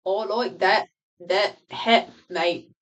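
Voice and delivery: broad Australian accent demo. Shown as a demonstration. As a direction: put on an Australian accent on request. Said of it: "That's my Australian accent".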